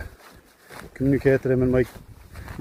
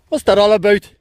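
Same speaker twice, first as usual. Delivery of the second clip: put-on Northern Ireland accent; 6 semitones higher, 8 dB louder than usual. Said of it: "in a Northern Ireland accent".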